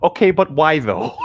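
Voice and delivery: a dim, puzzled why; imitating Emmett. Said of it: "Just imagine Emmett".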